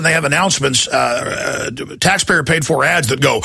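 normal talking voice

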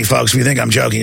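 ordinary talk

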